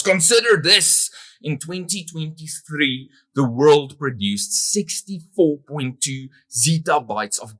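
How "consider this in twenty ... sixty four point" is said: sudden high, loud speaker's yell; mock yell. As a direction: jolt the sleepers awake with a raised voice. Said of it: "before the next speaker yells".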